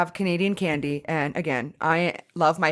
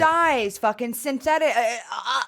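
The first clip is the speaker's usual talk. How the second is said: laughing outrage; 5 semitones higher than usual. Real, not acted. Outraged about candy ingredients.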